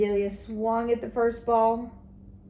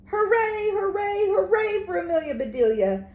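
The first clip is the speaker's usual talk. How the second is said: high, louder cheer; 10 semitones higher, 6 dB louder than usual. A narrator's reading. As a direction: cheer the hero home. Crowd cheering her.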